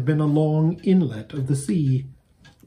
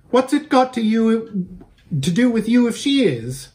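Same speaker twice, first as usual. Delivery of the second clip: high, loud fierce challenge; 9 semitones higher, 3 dB louder than usual. Acted. Strange rider defending her horse, fierce and high. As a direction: bark it back in defiance. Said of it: "said the strange rider fiercely".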